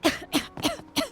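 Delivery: high strained cough; a sharp, choking cough. Acted. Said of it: "Take it easy".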